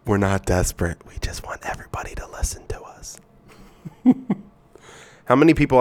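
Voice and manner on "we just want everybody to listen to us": soft unvoiced whisper; whispered aside. Real, not acted. To listeners, a joking whispered aside. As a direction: let it slip out as a secret.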